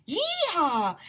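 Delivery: yelling yeehaw. A narrator's reading. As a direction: cheer as you run to the hole. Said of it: "they all ran over yelling".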